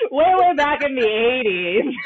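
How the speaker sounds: high, drawn-out words through laughter; laughing. Real, not acted. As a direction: stretch it out while cracking up. Joking along amid shared laughter.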